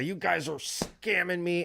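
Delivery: put-on sulking at the parking booth; mock whiny accusation. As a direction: drag it out like a wronged customer. Retold complaint at parking attendants.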